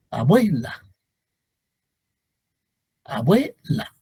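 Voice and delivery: "Abuela" with "bue" as one syllable; fuse U into E, then set off "la" in "Abuela"; diphthong demo. Shown as a demonstration. Teacher modelling another diphthong, careful "Abuela". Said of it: "Igual que abuela".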